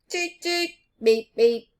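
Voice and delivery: playful horn imitation; horn noises. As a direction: honk like a bus.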